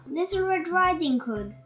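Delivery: ordinary delivery, nothing marked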